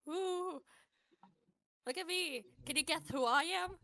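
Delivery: high, loud showman's teasing; showy performer. Acted. Benji performing shapeshifting tricks for kids, showy and high. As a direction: play it up for a young crowd. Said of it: "going to keep performing for them".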